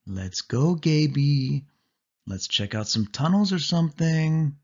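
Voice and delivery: teasing coax. A narrator's reading. Sari teasing, coaxing cousin.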